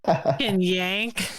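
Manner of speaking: slow, slurred tipsy drawl. Real, not acted. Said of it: "I'm kinda tipsy".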